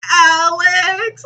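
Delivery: high, loud, stretched-out call; mock screaming kid. Imitating Ellie calling from the leaves, mock yell. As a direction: holler the name like a whiny child, hammed-up. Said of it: "she wants to be like".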